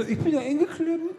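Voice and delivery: high, squeezed little angel. Retold or imitated. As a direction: squeak like a trapped angel. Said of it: "Und der Engel so".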